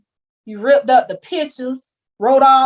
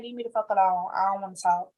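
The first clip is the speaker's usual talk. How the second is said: muttered, much softer mock anger; retold mad brush-off; 8 dB quieter than usual. Telling hosts how she snapped at students, softer, sullen. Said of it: "I'll be mad".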